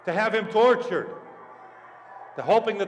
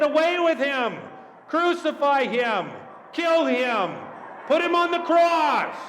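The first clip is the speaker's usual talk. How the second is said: high, loud mob cries; crowd shouting; 8 semitones higher, 5 dB louder than usual. Acted. Mob rejects Pilate's appeal, shouting high. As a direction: shout each demand with full force. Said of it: "they are shouting".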